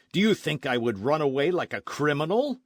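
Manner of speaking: loud, indignant outburst; cried out. A narrator's reading. Don Carlos insulted by the sergeant, loud angry cry. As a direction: fling the insult back at him. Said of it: "he cried".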